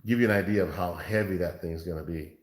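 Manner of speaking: ordinary delivery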